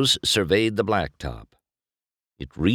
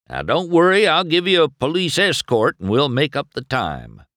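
high, raised voice of an insistent sheriff; 8 semitones higher, 4 dB louder than usual. A narrator's reading. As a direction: offer help urgently, pitched up. Narrator voices Sheriff offering an escort.

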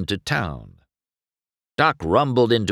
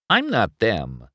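an edge. A narrator's reading. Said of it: "an edge in his voice".

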